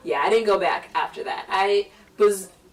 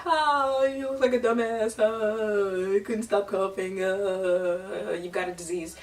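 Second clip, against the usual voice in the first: lightly voiced mocking older brother; teasing brother; slower than usual. Older brother taunting his sister in the car, lightly mocking. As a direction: needle her in a taunting singsong. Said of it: "here's my brother in my ear".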